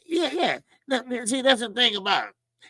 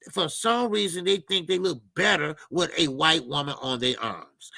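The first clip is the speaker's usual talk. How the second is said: ordinary talk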